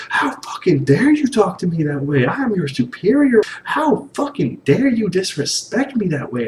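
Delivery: high, mock-indignant scolding; lit into him. Superior scolding a subordinate in a high, hammed-up fury. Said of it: "she just, like, lit into him".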